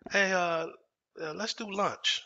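put-on light, drawn-out friendly invitation; Robert. HR calling the employee after the argument, imitated friendly invite. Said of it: "Robert, H R calls me".

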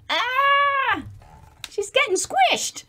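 squeaky high alarm; high-pitched mock panic; high squeal. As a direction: squeal as if being squashed. Girl stuck under sleeping dragon.

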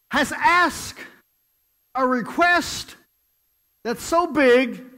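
loud, high, slow triumphant proclamation; proud king. Acted. King praising the man's huge request, loud triumphant proclamation.

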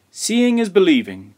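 a storyteller's lightly exclaimed line; excited cry. A narrator's reading. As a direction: cry it out with some gusto. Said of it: "cried Grandfather".

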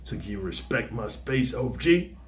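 hushed, haunted ex-con asking for space; fresh out of solitary. Shown as a demonstration. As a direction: keep it low and far away, like he's still hearing voices in the hole. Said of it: "You talk like that".

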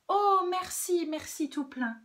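high, loud burst of gratitude; effusive put-on sweetness; gushing thanks. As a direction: thank her with overdone delight. Wolf feigns sweet gratitude.

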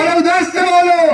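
high call to the crowd; yelling across the room. Real, not acted.

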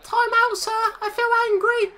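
put-on high, childish whine asking for a timeout; mock schoolkid voice. Mocking Harry as a schoolkid begging for timeout, whiny child voice. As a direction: mimic a sulking pupil begging the teacher. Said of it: "Harry wants a timeout card".

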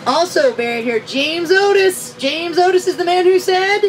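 loud, projected sightseeing commentary; big guide voice building to a cue; tour guide spiel. Pretend. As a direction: announce the landmarks to a whole bus.

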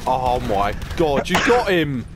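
usual speaking voice

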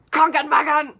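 loud, high barked KRANKENWAGEN; hammed-up angry shout on KRANKENWAGEN; mock angry German. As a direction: bellow KRANKENWAGEN like a caricature of furious German. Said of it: "It's not this".

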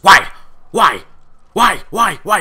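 loud, high, repeated demanding why; mock shouting woman barking at the crew; retold barking. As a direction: bark the why at him over and over. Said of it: "she started barking at him".

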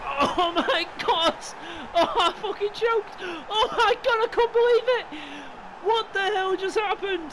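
high, loud, overwhelmed shouting; ecstatic disbelief. Real, not acted. Gamer stunned by last-minute winner, ecstatic shouting. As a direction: yell in stunned joy, choked by the celebration.

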